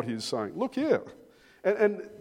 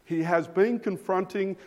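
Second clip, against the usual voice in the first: ordinary talk, nothing marked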